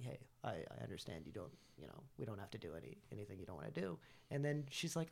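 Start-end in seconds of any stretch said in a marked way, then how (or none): 0.0-4.0 low, soft reassuring voice; retold reassurance. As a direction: let them off gently, quietly. Retells reassuring the upset partner.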